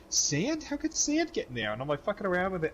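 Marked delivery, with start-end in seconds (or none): 0.1-1.7 higher, mock-innocent wife; wife's mock innocence. Wife feigns surprise about sand, higher, mock innocent. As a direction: play dumb, pitched up. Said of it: "my wife's like".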